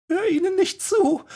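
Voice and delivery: high, tearful begging; broken pleading. A narrator's reading. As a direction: beg him, voice cracking. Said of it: "flehte Emma, ihre Stimme gebrochen".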